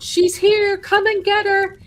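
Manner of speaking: high, loud, eerie chant; possessed high-pitched summons; eerie high call. Retold or imitated. Possessed man calling out.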